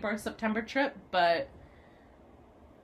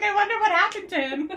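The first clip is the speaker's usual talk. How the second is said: mock wondering voice; 11 semitones higher, 6 dB louder than usual. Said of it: "You're like".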